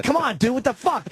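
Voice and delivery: angry yell. Retold or imitated. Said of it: "And I was like".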